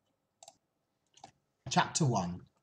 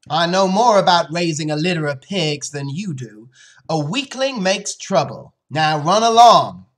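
loud, raised, firm dismissal; stern father; 7 semitones higher, 13 dB louder than usual. Acted. Father sends his daughter away, loud and stern.

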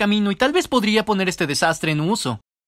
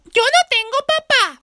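put-on high, slow small-child voice; little kid voice; 3 dB louder, slower than usual. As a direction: say it like a sad little boy to his teacher. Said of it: "fui con mi profesor básicamente como". (voz aguda y lenta de niño pequeño)

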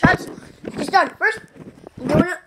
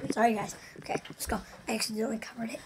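whispering; 4 semitones lower, 12 dB quieter, much faster than usual. Real, not acted. Whispers while filming.

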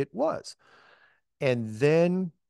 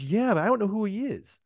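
lightly voiced Cammy; 8 semitones higher than usual. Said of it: "She goes".

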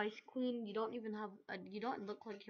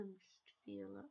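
low whispered aside; 10 dB quieter, slower than usual. Real, not acted. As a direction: whisper it like a hunch.

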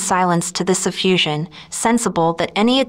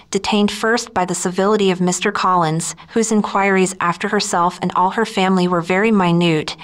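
ordinary delivery

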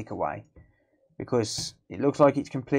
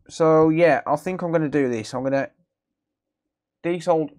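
talking through hiccups; words broken by hiccups; suppressing hiccups; 4 semitones higher, 6 dB louder than usual. Real, not acted. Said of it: "Sorry, I've got the hiccups".

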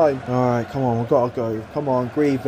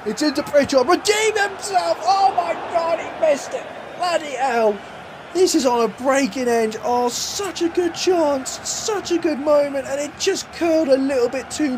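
higher match call that sags on the miss; excited commentary; 10 semitones higher than usual. Acted. Narrating his match as the striker misses, excited then let down. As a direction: cry out the chance, then groan over it.

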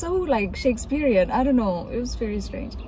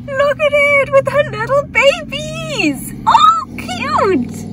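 squealing high and loud over chicks; gushing; 6 dB louder, slower than usual. Real, not acted. Seeing a hen with babies, high excited squeal.

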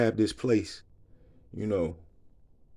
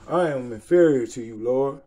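louder, emphatic answer to the Lord; loud humble exclamation; 6 dB louder than usual. Real, not acted. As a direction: cry out the line as your own confession. Exclaims his answer to the verse.

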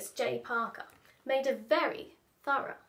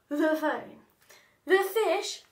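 ordinary delivery

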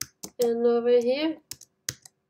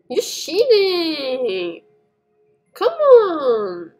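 loud, high, drawn-out complaint; indignant whine; 6 semitones higher, 6 dB louder, much slower than usual. Real, not acted. Player accusing the teacher of cheating, indignant whine. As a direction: wail it in protest, stretching the words.

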